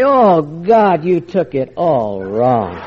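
slow, drawn-out wounded denial; sarcastic routine. Retold or imitated. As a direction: pretend to be hurt, stretch it out. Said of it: "I'd really go into my routine".

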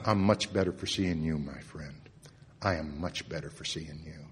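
soft, tender retold words of a friend; gently, like holding someone's face. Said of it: "and he'd say".

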